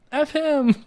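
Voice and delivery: high, drawn-out little sidekick voice; concurring kid. Retold or imitated. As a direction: chime in behind mom as a cheeky kid. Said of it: "concurring with her".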